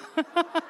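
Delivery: short high laugh; amused chuckle; burst of laughter. Real, not acted. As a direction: laugh at the punchline. Laughs at his own anecdote.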